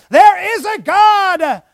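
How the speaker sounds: there is a God shouted high and slow; loud cry. Retold or imitated. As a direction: proclaim it at full voice. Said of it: "It cries out".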